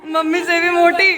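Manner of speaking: high, laughing tease; laughing teasing. Real, not acted. Laughing while teasing about weight. (हँसते हुए ऊँची आवाज़ में चिढ़ाना)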